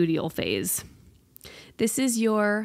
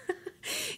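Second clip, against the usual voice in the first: breathy laugh; 12 dB quieter than usual. Real, not acted. Laughing at her own misery.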